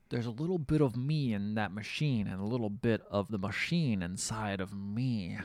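low, soft mock-sinister voice; hushed retold menace, slowing at the end; eerie villain confession. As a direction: confide it quietly, like a storybook villain.

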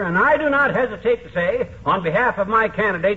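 mock-grand campaign oratory; pompous campaign speech. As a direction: hold forth like a windbag politician at a rally. McGee orates for his candidate.

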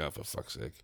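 very low, hushed mock grumble; exasperated aside. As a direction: grumble privately, well under your breath. Private groan at the chatty gardener.

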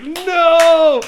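loud, high-pitched mock wail of protest; retold anguished cry. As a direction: cry out in comic horror. Retold horror at pigeon mess.

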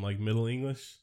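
usual speaking voice

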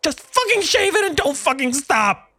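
high, strained, desperate order; frantic command. A narrator's reading. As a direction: spit it out in panic. Desperate man commands barber.

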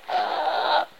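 high agonized wail; sustained pained "ah"; yell of agony. A narrator's reading. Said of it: "Yells of agony".

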